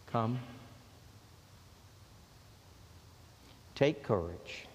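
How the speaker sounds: low, soft and reassuring; tender voice of Jesus. Acted. Jesus calming the fearful, low and tender. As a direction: gently comfort the frightened. Jesus's comforting words repeated.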